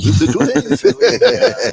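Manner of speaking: laughing French echo. Acted. Host echoing the guest's French, slow and laughing. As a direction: savour the French phrase through a laugh.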